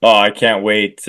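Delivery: ordinary delivery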